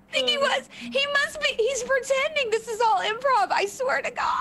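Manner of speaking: high-pitched, insistent outburst; excited accusation. Real, not acted. Accuses friend of faking the whole bit.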